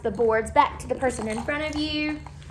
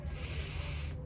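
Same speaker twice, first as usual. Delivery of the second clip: whispered letter; 12 dB quieter than usual. Shown as a demonstration. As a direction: whisper the S sound. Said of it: "So let's try it".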